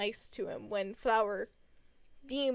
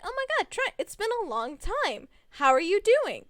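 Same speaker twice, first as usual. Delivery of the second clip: much higher, louder delighted hello; excited greeting; 8 semitones higher, 7 dB louder than usual. Retold or imitated. Greeting Trent at middle school, thrilled and high. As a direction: greet an old friend with delight. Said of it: "So I'm like".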